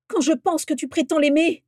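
high, loud, furious reproach; outraged roar. A narrator's reading. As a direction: throw the accusation at him, incensed. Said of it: "rugit Vera". (reproche furieux, fort et aigu)

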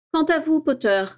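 lightly voiced strict professor; stern teacher. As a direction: address the pupil with mock severity. Teacher addresses Potter sternly.

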